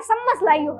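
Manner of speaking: सम्मस said with s for ch, a soft mock rural accent; imitating local dialect. Teacher mimics Jat villagers' speech for students, lightly accented. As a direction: mimic a villager asking for a spoon, सम्मस लाइयो. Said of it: "चम्मच ऐसे नहीं बोलते".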